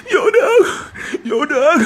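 high, whimpering imitation of a crier; mock bawling. Warning that this greeting means tears, mock bawling. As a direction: ham up a tearful greeting. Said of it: "try know them a ball".

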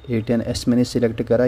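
ordinary talk

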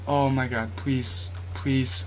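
soft, hushed begging under his breath; nervous pleading. Real, not acted. Gamer begging the game under his breath, hushed plea. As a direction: plead quietly, barely voiced.

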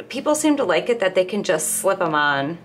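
ordinary delivery

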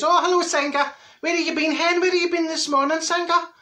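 high-pitched hello to a passing pal; high, chatty mock greeting; acted friendly greeting. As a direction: call out in a high singsong.

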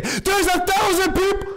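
high, drawn-out wail of stress; overwhelmed cry. Real, not acted. Streamer with a thousand viewers watching, overwhelmed cry. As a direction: stretch it out in a high, panicky cry. Overwhelmed by huge audience during choice.

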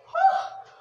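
sharp loud gasp of fright; startled gasp. Real, not acted. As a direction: gasp as if jumped at. Sudden scare in game.

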